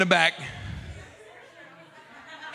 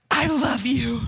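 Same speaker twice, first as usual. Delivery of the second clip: shy love confession; 7 semitones higher, slower than usual. Retold or imitated. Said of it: "She said".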